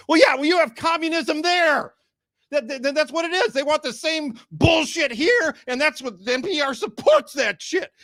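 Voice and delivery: hammed-up angry shout; mock furious rant. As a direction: yell it like an outraged passenger. Ranting at the driver.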